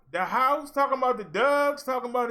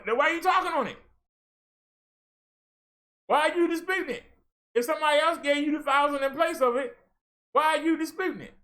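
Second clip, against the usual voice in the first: indignant ranting; 5 semitones higher than usual. Real, not acted. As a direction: hammer the questions at her. Ranting back at the woman.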